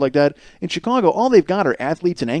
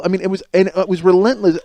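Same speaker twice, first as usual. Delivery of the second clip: ordinary talk, nothing marked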